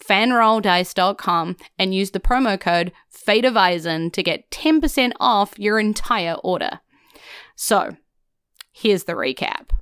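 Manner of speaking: ordinary talk, nothing marked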